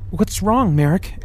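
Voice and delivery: lightly voiced woman, a touch of fear; suddenly unsure and scared; frightened. A narrator's reading. Ebony asking Merrick what's wrong, mildly frightened.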